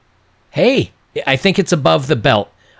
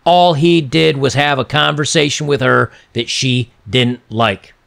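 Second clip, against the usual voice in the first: ordinary talk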